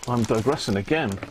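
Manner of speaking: ordinary delivery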